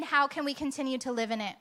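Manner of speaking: normal talking voice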